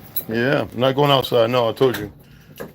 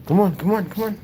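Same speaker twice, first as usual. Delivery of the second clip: higher, coaxing call to a pet; coaxing the dog; 7 semitones higher than usual. Real, not acted. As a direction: sweet-talk the dog to follow you upstairs.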